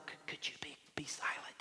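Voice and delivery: very soft, tentative polite request; kind, hesitant whisper. Acted. Somebody in a theater to popcorn eater, kind whisper. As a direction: whisper it apologetically, stumbling a little.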